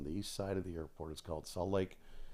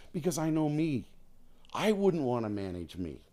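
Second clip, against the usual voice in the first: higher, louder mock retort; emphatic retort; 8 semitones higher, 7 dB louder than usual. Telling the bosses why not, loud and high. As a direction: fire back with a self-mocking punch. Retold own reply, emphatic.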